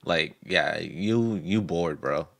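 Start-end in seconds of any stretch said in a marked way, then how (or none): none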